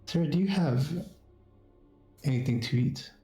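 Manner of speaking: lightly voiced pleading stranger woman; a hint of a hungry woman begging; woman's voice. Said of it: "you hear a woman's voice".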